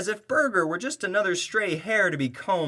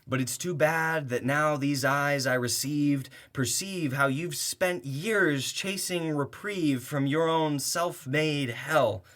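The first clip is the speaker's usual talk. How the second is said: ordinary talk, nothing marked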